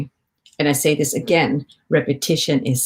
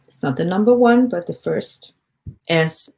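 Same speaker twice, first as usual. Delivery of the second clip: normal talking voice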